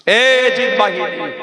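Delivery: high, slow, drawn-out address; calling out. Acted. Husain addressing the army at Karbala, drawn-out call. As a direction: hail an army with stretched words.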